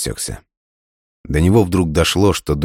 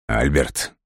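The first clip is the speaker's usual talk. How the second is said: softer curt unfriendly call; 3 semitones lower, 4 dB quieter than usual. A narrator's reading. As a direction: snap the name coldly. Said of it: "Голос Дмитрия тоже прозвучал совсем недружелюбно". (недружелюбно, коротко)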